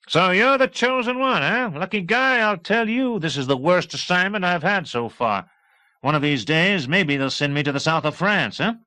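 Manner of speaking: jaunty talkative flyboy; high, loud brash pilot; cheerful pilot. A narrator's reading. Erickson voiced high and loud.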